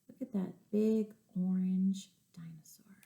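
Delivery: soft whispered aside. Real, not acted. Storytime teacher whispering to kids about the picture. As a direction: lean in and whisper.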